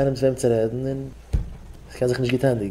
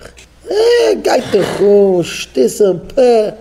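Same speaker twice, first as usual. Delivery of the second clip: high wailing sob, much louder; mock crying; 9 semitones higher, 8 dB louder, much slower than usual. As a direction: wail like a weeping woman. Acting a crying complaint. (gespieltes Weinen)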